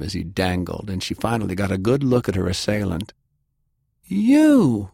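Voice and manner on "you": high, loud, drawn-out cry; startled recognition. A narrator's reading. Delilah recognises her assailant, startled recognition. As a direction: exclaim it high and loud at a known face.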